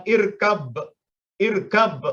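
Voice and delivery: "irkab" with a bouncing, released final b; qalqalah bounce. Shown as a demonstration. Teacher shows the bounced b at the end of "irkab". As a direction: let the b of "irkab" echo after the stop. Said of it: "ya, ada pantulan".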